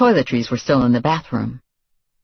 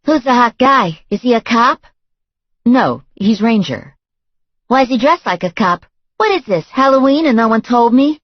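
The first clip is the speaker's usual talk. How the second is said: put-on high, loud, brassy young woman voice; brash young woman; 10 semitones higher, 5 dB louder than usual. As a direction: play her pushy and nosy. Voiced girlfriend character.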